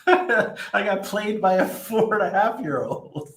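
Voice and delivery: slow, high, still laughing through it. Real, not acted. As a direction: let the laughter carry every word. Laughing at being outplayed by son.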